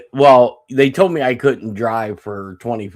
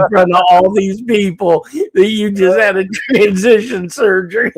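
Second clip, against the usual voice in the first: laughing through mom's scolding; 8 semitones higher, 5 dB louder than usual. Retold or imitated. Mom scolding, narrator laughing.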